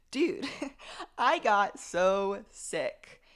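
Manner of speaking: "SO SICK" drawn out slowly and loud, with a laugh after "Dude"; amused, slow, punchy emphasis on "so sick"; emphatic repeat. Real, not acted.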